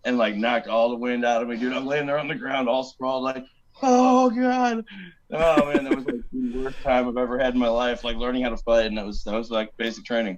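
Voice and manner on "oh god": pained groan. Retold or imitated. Knocked breathless on ground.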